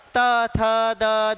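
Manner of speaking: त, थ, द sounded out slowly, one by one; slow letter demo. Shown as a demonstration. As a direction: say त, थ, द slowly and clearly. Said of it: "त वर्ग". (त, थ, द धीरे-धीरे साफ़ उच्चारण)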